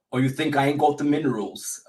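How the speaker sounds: lightly put-on Cockney gangster line; a touch of London gangster accent. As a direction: quote the film in a hint of Cockney. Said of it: "the British and Cockney".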